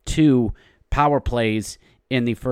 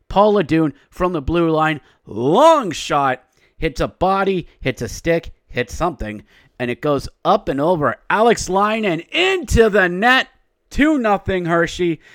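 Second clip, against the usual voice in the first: excited call of a fluky goal, pitched up; hockey play-by-play; 6 semitones higher than usual. Real, not acted. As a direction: narrate the goal like a broadcaster.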